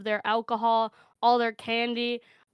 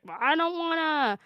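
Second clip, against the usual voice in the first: put-on whiny kid; 5 semitones higher than usual. Older brother refusing his mom, mock whining. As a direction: imitate a reluctant kid's whine.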